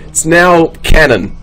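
ordinary talk

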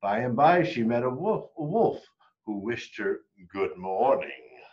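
ordinary delivery

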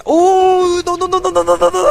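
high-pitched panicked "no no no" into a drawn-out wail; high, loud yelp trailing away; long high wail. Real, not acted. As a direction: cry out a rapid string of high no's, then wail. Game character is dying.

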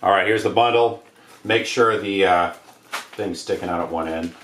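ordinary talk, nothing marked

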